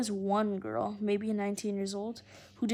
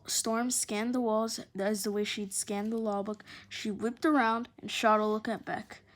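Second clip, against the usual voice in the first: ordinary delivery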